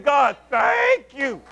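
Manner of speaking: slow, high, stretched-out thanks; grateful praise. Acted. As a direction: draw the thanks right out.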